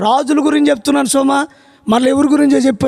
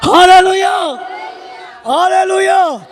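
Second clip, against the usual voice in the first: rally the congregation with hallelujah; shouted hallelujah; 5 semitones higher, much slower than usual. Real, not acted. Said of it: "గట్టిగా హల్లెలూయా చెప్పండి".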